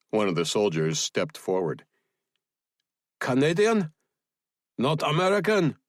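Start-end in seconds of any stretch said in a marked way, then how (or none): 3.2-5.8 high-voiced suspicious foreign soldier; doubting soldier, pitched high; questioning soldier. A narrator's reading. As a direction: query it sharply in a much higher voice.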